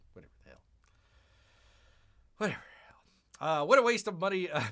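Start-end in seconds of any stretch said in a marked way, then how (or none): 0.1-0.6 very soft, low under-the-breath remark; muttered aside. Real, not acted. Streamer grumbling about the Minecraft movie, muttered. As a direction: grumble it to yourself. Muttered then repeated aloud.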